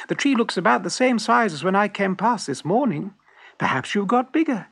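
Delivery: high-pitched small-animal character voice; teasing little weasel. A narrator's reading. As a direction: answer coolly in a high weasel voice. Weasel answers the fox.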